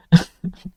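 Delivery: a brief bright burst of laughter; short high laugh. Acted. Shopkeeper laughs at the old man's cunning, amused.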